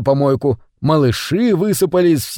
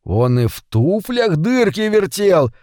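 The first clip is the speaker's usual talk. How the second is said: higher, slower exclamation of surprise; astonished gasp; 5 semitones higher, slower than usual. A narrator's reading. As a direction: gasp out your amazement, lightly acted. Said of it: "ахнул Митя".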